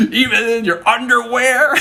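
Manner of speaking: high-pitched mock-incredulous taunt; hammed-up cheeky challenge; teasing question. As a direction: needle a friend with a silly question. Friend's teasing punchline question.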